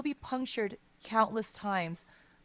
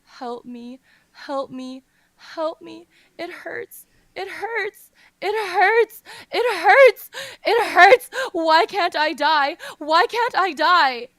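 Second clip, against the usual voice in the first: building from pleading to shrieking, much louder and higher; anguished scream; 9 semitones higher, 13 dB louder, slower than usual. A narrator's reading. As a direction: scream in unbearable pain. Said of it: "His heart is silently screaming".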